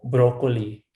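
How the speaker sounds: "broccoli" with full Filipino o's; Filipino accent. Shown as a demonstration. Guest demonstrating the O sound, Filipino accent. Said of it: "O, yung O".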